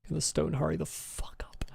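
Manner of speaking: impatient whisper. Real, not acted. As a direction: hiss it under your breath. Impatient aside while waiting.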